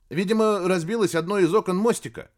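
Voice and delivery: high, loud nervous report; frightened radio operator. A narrator's reading. Radio operator answering the admiral, nervous. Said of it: "радист отчаянно боится".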